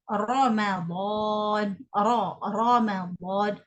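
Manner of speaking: Ramadan drawn out slowly with a heavy, thick ra. Shown as a demonstration. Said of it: "ra itu sendiri berbaris atas". (Ramadan dengan ra tebal, dibaca perlahan)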